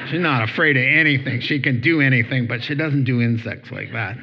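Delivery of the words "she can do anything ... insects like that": ordinary talk, nothing marked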